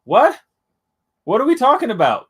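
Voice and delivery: 难以置信地高声发问; shocked disbelief, loud and high; incredulous. Real, not acted. Incredulous at debate claims.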